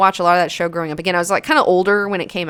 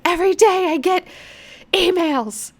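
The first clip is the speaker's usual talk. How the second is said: high, put-on complaining voice; hammed-up inbox complaint; mock whiny meme voice; 12 semitones higher than usual. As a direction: whine it like a weary inbox owner. Acting out meme caption.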